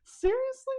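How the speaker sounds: high, loud choked-up disbelief; overwhelmed disbelief. Retold or imitated. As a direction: voice rising in stunned emotion. Retold overwhelmed reaction.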